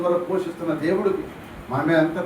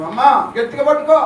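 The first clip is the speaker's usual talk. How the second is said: put-on child calling out; 6 semitones higher, 6 dB louder than usual. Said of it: "వెనకాల కూర్చున్నావు".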